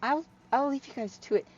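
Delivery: high, stammering apology; flustered Hyla. Acted. Hyla leaving the guys to it, flustered. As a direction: trip over words while excusing yourself.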